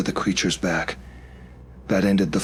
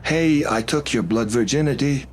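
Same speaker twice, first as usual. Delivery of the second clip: higher, calling across the room; calling after; 7 semitones higher than usual. Acted. Jacek calls after departing Fiona, teasing call. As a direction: call after her as she leaves. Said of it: "he called after me".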